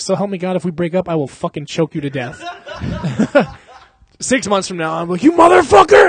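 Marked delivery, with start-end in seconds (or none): 5.2-6.1 high, loud shout of rage; mock furious yell. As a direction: roar it at the guy you're hunting. Imagined attack on friend.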